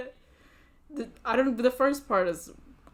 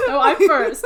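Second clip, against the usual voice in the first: loud, higher protest through laughter; mock squabbling; 4 semitones higher, 13 dB louder than usual. Co-host cutting in to claim first introduction, mock squabbling. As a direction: playfully insist over the others.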